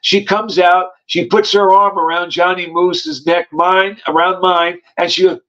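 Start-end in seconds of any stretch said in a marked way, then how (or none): none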